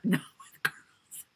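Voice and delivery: whispered through a laugh. Real, not acted. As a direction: whisper it, giggling. Laughing denial.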